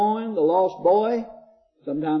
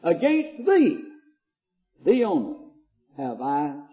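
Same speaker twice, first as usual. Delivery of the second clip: fervent psalm reading; 4 semitones higher than usual. Acted.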